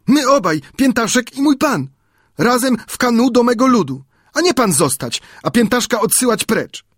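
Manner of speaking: put-on high, eager native boy insisting; high-pitched pleading to stay together; Friday, earnest. A narrator's reading. Friday voiced by narrator. (wysoki, gorliwy głos Piętaszka)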